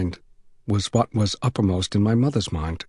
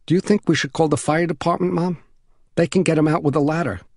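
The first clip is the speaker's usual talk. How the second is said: put-on higher child's voice, earnest suggestion; eager young boy; 6 semitones higher than usual. Son asking his mom to call firemen, earnest and higher.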